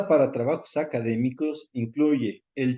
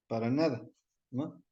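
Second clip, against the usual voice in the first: normal talking voice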